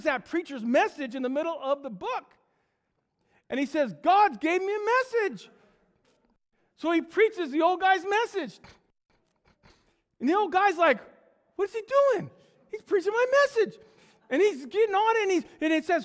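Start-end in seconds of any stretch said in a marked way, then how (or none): 4.0-5.5 high, loud, slow preacher's cry; triumphant proclamation. Acted. Young preacher proclaims to congregation. 11.5-13.8 high, loud, incredulous outcry; acted alarm. Old preacher outraged at stolen message.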